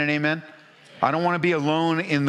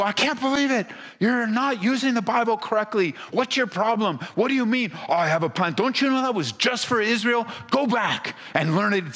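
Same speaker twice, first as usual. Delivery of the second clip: a hammed-up, higher scolding voice, pitched up high; mock scolding God; 6 semitones higher than usual. Pastor mocks a harsh God scolding a praying believer, hammed-up scolding. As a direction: play an exasperated God scolding a mistaken believer. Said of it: "He's not gonna go".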